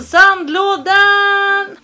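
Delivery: sandlådan loud, each syllable hugely stretched; grandly drawn-out sandlådan; mock-French sandlådan. Shown as a demonstration. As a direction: belt out sandlådan like a French brand name. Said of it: "Låter nästan som".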